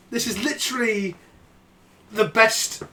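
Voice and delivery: normal talking voice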